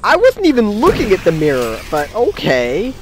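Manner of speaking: high, loud jump-scared yelp; scared horror game streamer. Real, not acted. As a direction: blurt it out after a fright. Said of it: "legitimately jump scared me".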